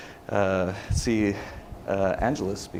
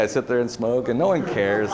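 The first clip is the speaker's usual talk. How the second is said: usual speaking voice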